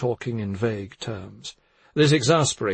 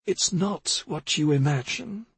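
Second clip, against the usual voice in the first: higher-pitched character voice, measured; cautioning older woman; 7 semitones higher than usual. A narrator's reading. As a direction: voice a woman carefully warning her listeners.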